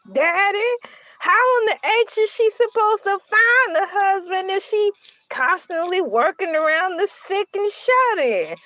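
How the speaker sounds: high-pitched, hammed-up daughterly objection; exasperated protest. Acted protest to dad.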